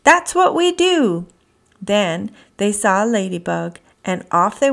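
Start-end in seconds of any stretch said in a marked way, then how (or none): 0.0-1.3 laughing. Acted. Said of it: "they laughed".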